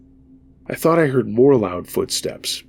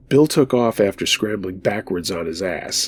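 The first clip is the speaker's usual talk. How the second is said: plain reading voice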